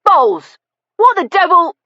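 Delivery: high, loud angry shout; furious bellow. Acted. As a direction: bark at the butler in a rage. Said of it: "drawing furiously on his cigar".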